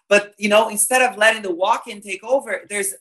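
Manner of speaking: usual speaking voice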